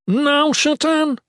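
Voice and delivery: high, loud, drawn-out command; yell. A narrator's reading. Ethan signalling Shatan to strike, urgent yell. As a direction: yell the order at a high pitch. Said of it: "Ethan yelled".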